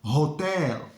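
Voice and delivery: hotel drawn out slowly with an open o and stress on HO; slow wrong version. Shown as a demonstration. Teacher showing the common learner mistake with hotel. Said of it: "That's incorrect".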